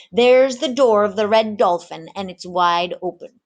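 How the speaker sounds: a one-armed rogue selling gold to sailors; swaggering pirate mate. Acted. Pirate mate lures the tavern crew, loud and swaggering.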